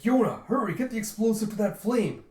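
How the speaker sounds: loud, high acted command; urgent shout. Yun urging Yona toward the flame, urgent shout. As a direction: shout for help with real urgency.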